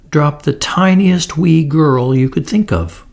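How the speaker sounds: ordinary delivery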